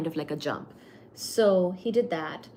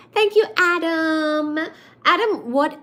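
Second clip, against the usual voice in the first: gushing thanks; 7 semitones higher, 7 dB louder than usual. Real, not acted.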